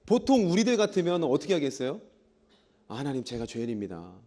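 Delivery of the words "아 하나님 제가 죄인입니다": low, soft penitent plea; humble confession. Pretend. Ordinary believer answering God's flood of questions, humble confession. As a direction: confess meekly under your breath.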